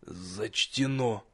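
hoarse, slow, strained wheeze; queasy. A narrator's reading. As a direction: croak it out, fighting nausea. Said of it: "просипел".

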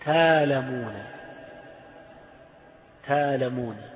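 تالمون with a long alif, no glottal stop, slow; تالمون drawn out as taa-lamoon; hamza to alif. Shown as a demonstration. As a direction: stretch تالمون into a long open aa. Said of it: "إذا بدلنا الهمز".

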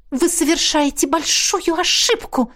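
high, tense hissed threat; hissed warning. A narrator's reading. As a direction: hiss it as a warning. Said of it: "прошипела она".

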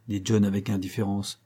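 usual speaking voice

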